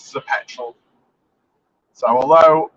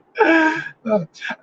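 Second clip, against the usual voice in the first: laughing; 12 semitones higher than usual. Real, not acted. Laughs at Wales voting Leave.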